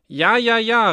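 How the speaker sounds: high, loud ja, ja, ja; loud, knowing exclamation; Meister Böck's cry. Acted. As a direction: call it out like a tailor who saw it coming. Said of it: "rief Meister Böck".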